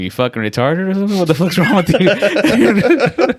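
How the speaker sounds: high, loud, laughing mock outrage; hammed-up yelling waiter, cracking up; mock angry restaurant worker. Retelling the restaurant worker scolding him, loud and laughing. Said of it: "He's like".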